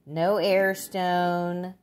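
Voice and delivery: ordinary talk, nothing marked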